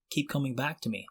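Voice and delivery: normal talking voice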